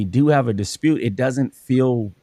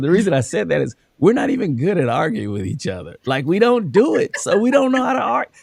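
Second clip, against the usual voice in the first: amused wife; 7 semitones higher than usual. Retold or imitated. Wife quoted, rising high.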